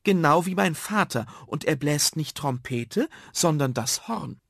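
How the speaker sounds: put-on high, self-important child. Karl corrects the others about the horn, self-important. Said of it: "fügte wichtig hinzu".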